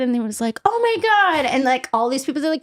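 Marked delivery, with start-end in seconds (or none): 0.7-1.4 high excited gasp; excited fan. Retold or imitated. Fans recognizing Paul, high excited gasp. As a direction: squeal like a starstruck fan.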